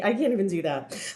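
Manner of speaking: ordinary delivery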